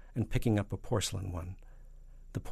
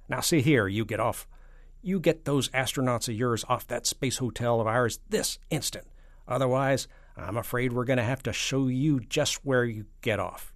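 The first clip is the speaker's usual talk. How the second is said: loud, raised presidential scolding; stern telling-off; 4 semitones higher, 6 dB louder than usual. Acted. President ordering the premier off his hotel, stern. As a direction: lay down the law loudly.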